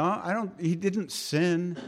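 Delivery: ordinary talk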